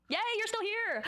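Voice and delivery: high, bright cheer of praise; mock excited cheer. Vlogger congratulating viewers who stayed silent, mock excited cheer. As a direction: cheer like you're rewarding a small child.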